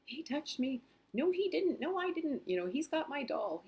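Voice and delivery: put-on high, whiny kids bickering; squabbling kids. Mom imitating her bickering kids, high and whiny. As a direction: mimic the children squabbling over a doll. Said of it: "the kids were fighting".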